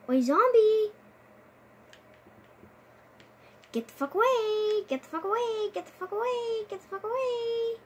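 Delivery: high, loud repeated shout; frantic yelling. Real, not acted. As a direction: scream them off the wall. Zombies attacking his wall.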